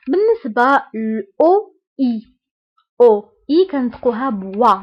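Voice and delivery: usual speaking voice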